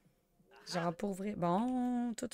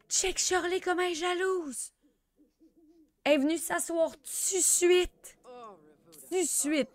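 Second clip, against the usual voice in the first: real thrilled gossiping; excited gossip; 10 semitones higher, 7 dB louder than usual. Real, not acted. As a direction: gasp then much higher, loud exclaiming. Gasps at jealous character.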